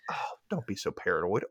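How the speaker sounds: whispered. Acted. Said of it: "And Susan looks at him and says".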